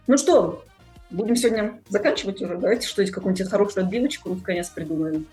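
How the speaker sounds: ordinary talk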